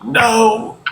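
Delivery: high, wailing fake-tearful no; hammed-up sobbing no; mock sob. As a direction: whimper it like a put-on breakdown. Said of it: "And I'm like".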